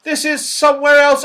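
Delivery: high, loud protest; shrieking Saucepan Man. A narrator's reading. Saucepan Man in the wrong land, shrieking. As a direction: wail it in a comic high voice. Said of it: "shrieked the saucepan man".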